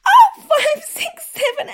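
loud, high yelps while counting; pained cry. Acted. Yelping the count aloud as each smack lands.

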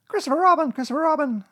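very high, loud excited call; Piglet crying out. A narrator's reading. Piglet appealing to Christopher Robin, crying out. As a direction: cry the name in a squeaky voice. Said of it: "cried Piglet".

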